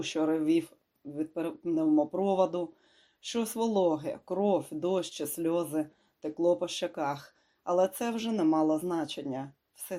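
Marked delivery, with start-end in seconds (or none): none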